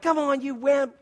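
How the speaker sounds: playful retold taunt; teasing kids. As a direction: lightly imitate kids egging dad on. Kids teasing scared dad, voiced.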